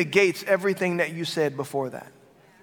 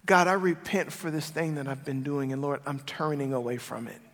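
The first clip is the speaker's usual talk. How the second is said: softer, lower quiet confession to God; humble prayer; 5 semitones lower, 6 dB quieter than usual. Shown as a demonstration. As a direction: pray it humbly, almost to yourself. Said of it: "This is how we need to do it with God".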